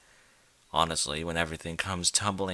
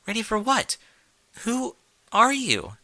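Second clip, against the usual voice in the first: much higher, startled and confused; high frightened mare voice; 14 semitones higher, 3 dB louder than usual. Acted. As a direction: squeak it out scared and lost. Frightened Twilight asks captor.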